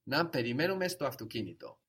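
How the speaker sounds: let's-do-it tune on Να περιμένουμε, falling through αυτοκίνητο; affirmative tone. Shown as a demonstration. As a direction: state Να περιμένουμε στο αυτοκίνητο as a suggestion, closing on a fall. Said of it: "affirmative tone of voice".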